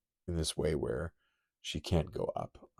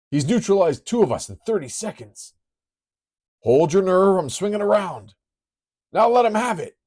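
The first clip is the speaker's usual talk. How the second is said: loud, much higher battle yelling; urgent shouted orders; 12 semitones higher, 10 dB louder than usual. Acted. Pilot rallies crew in combat, loud high yelling. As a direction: bark orders mid-dogfight.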